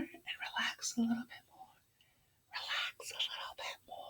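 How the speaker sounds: hushed, trailing off to almost nothing; fading whisper. Shown as a demonstration. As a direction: soften down into a bare whisper. Voice mimics the relaxing hand.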